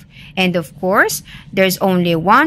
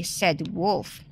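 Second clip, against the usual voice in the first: ordinary delivery, nothing marked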